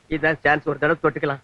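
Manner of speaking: very low, hushed murmur; sly aside. Acted. Man spotting a chance to touch her hand, murmured aside. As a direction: drop far down as if thinking aloud. Secret aside while being examined.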